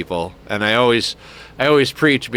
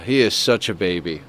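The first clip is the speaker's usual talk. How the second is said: normal talking voice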